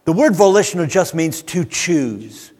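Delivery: normal talking voice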